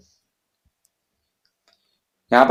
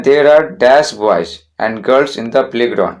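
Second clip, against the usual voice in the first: very slow, word by word, saying "dash" for the gap; slow dictation read; much slower than usual. Shown as a demonstration. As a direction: read the sentence slowly for the students to follow. Teacher reads exercise sentence.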